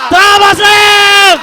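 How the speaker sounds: high, slow, stretched catchphrase; slogan shout. Real, not acted. Hosts welcome viewers back, calling out the slogan. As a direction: holler the show name like an announcer.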